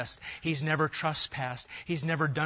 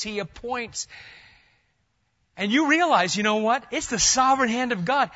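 loud, high, urgent sermon cry; full-force preaching; 7 semitones higher, 6 dB louder than usual. Acted. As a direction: preach it hard, as if pleading with the crowd.

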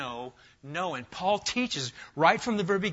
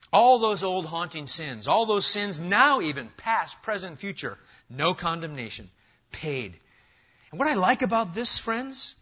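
louder, building pulpit cadence; full-force preaching; 3 semitones higher, 4 dB louder than usual. Acted. Pastor rousing his church on assurance, fervent preaching. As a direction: preach it out to the back row.

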